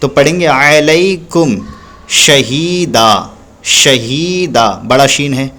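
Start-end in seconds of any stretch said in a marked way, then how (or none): 2.1-4.8 शहीदा slowly, with a long ही; stretched hee. Shown as a demonstration. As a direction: draw out the ही in शहीदा. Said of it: "इसलिए इसको खींचेंगे".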